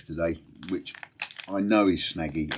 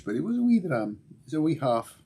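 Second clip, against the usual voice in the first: higher, hammed-up Scottish accent; poor Scottish accent; 10 semitones higher, 4 dB louder than usual. Answering viewers on his stream in a mock Scottish accent. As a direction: put on a bad Scottish brogue for the wee dram. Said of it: "very poor impersonation of a Scottish".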